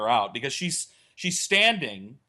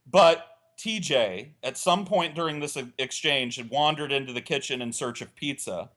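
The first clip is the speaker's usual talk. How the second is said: normal talking voice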